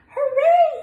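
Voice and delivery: high, loud hooray; duckling cheers after his splash, high and loud; joyful cheer. Acted. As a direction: cheer it out at the top of the voice. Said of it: "he cried".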